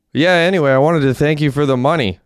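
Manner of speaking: put-on high, loud slurred drunk talk; slurring drunk. A narrator's reading. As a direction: slur every word like the town drunk. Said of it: "slurring his words".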